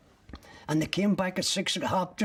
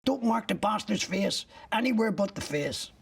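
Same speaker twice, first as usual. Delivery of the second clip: higher, urgent mock shouting of an order; retold shout; 6 semitones higher than usual. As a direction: call it out across the room, pitched up. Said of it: "somebody shouting".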